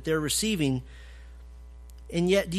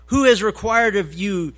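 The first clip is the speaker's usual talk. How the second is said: louder, rising preaching of God's words; forceful scripture reading; 5 dB louder than usual. Acted. Preacher reading Isaiah's rebuke, loud and rising. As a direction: proclaim it as God's indignant voice.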